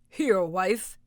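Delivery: loud gruff ogre call. Acted. Ogre calling his wife for breakfast, loud. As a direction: bellow for your wife. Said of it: "he cried".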